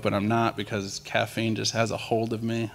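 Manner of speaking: usual speaking voice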